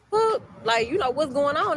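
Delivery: high-pitched, puzzled "what?"; mock startled questioning; retold surprise. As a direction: react baffled to sudden honking, pitch jumping up. Retold startled reaction to the horn.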